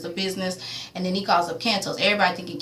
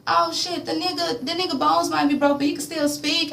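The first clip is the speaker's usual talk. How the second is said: a higher, taunting retold voice; mocking remark; 9 semitones higher than usual. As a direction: tease him in a pitched-up voice.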